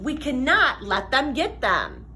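ordinary delivery

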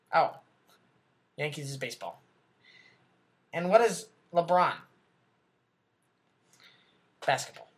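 ordinary talk

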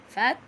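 "فتحاه" split as fat, then ta-ha; shadda with fatha. Shown as a demonstration. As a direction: halt on the first t of "فتحاه", then open into ta-ha. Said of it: "احنا هنجمع دي ساكن".

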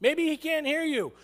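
high, jeering call; mock goading shout. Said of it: "he's like".

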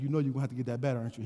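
low, hushed aside; confiding undertone. Retold or imitated. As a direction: lean in and keep it down. Attendant confides in the man.

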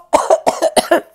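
short put-on cough; mock cough. Shown as a demonstration. Teacher showing kids a cough, mock coughing. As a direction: fake a little cough. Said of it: "A cough".

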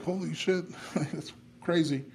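half-laughing mock shock at his own skin peeling off; recalling the moment of disbelief, high and laughing; retold shock.

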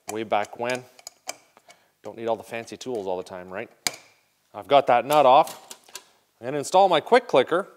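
ordinary talk, nothing marked

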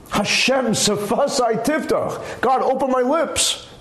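slow, earnest and deliberate prayer; heartfelt prayer. Shown as a demonstration. Opening prayer said slowly and with feeling. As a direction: pray it like you mean it. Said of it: "I'm not".

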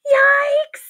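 high, loud startled cry; shocked teacher. Acted. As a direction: yelp in alarm.

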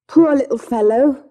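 a touch of pleading pity; pitying mother. Acted. Young mother speaks of her sick boy, pitying.

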